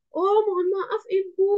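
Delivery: high, pleading customer-service sorry; soothing, high-pitched regret to an upset guest; empathetic apology. Shown as a demonstration. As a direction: apologise with exaggerated sympathy. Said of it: "intonasinya harus gimana cara berempati".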